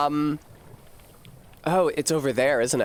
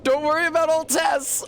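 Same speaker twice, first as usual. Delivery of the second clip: mock reassuring; 13 semitones higher than usual. Repeating the mock line higher.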